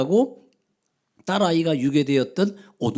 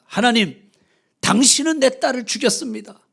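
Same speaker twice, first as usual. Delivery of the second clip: louder, higher accusing cry; anguished outcry; 6 semitones higher, 6 dB louder than usual. A narrator's reading. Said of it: "절규를 합니다".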